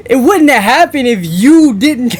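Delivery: high, loud protest through laughter; incredulous laughing. Real, not acted. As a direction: laugh in disbelief as you argue. Laughing disbelief at the excuse.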